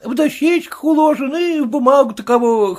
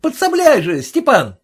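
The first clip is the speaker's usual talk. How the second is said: calling out; 6 semitones higher, 3 dB louder than usual. A narrator's reading. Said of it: "повторил Гаврила кучеру".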